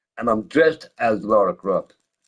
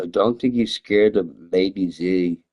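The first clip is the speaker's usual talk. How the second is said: normal talking voice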